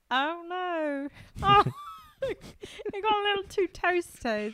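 high-pitched mock British dismay; British accent. British baker fretting over a toasted bake, mock dismay. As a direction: put on a dainty, high British voice. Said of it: "British people that are like".

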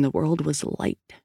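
ordinary delivery

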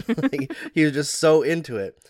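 ordinary talk, nothing marked

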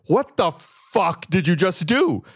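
mock-furious demand, stretched out; retold fury. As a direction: act out stunned anger, drawing the words out. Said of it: "like, anger and fury".

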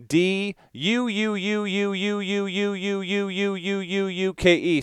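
high, slow stretched D U U U K E; drawn-out capital letters. Retold or imitated. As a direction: stretch the "D U U U U" up high like shouting caps. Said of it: "and then capital letters".

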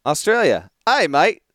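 playful Australian voice; Australian accent. Shown as a demonstration. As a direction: greet them like an Aussie mate. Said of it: "This is the Australian accent".

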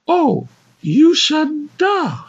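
slow, high dim lady; high woman voice. Acted. Woman voice, dawning realization.

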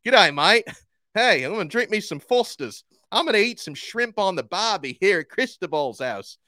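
high, loud hammed-up Aussie accent; Australian accent. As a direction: play a cheeky Aussie punter, Australian accent. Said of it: "I'm an Australian punter".

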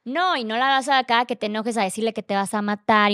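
ordinary talk

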